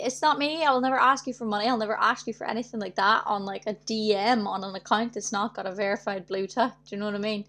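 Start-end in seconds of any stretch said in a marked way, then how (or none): none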